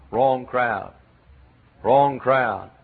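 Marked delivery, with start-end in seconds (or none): none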